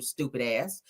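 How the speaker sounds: ordinary talk, nothing marked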